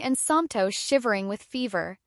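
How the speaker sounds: usual speaking voice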